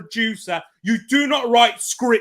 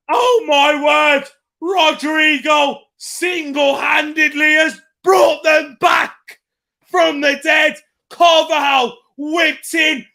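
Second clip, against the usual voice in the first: loud, thrilled play-by-play; breathless live commentary on a header; excited commentary; 3 semitones higher, 5 dB louder than usual. Real, not acted. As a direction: call the header in disbelief.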